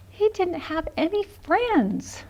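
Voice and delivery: put-on teacher reading a sad moment to kids; mock sad. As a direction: let the sadness land slowly.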